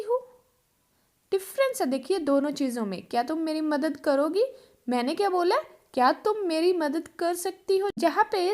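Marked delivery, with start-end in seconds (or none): none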